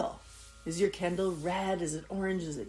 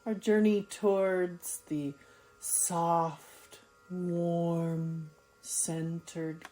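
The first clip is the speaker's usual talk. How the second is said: slow, calm yoga nidra voice with long pauses; soothing, unhurried guide; guided meditation; 3 semitones lower, 4 dB quieter, slower than usual. Acted. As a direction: let each word settle, drawn out and gentle.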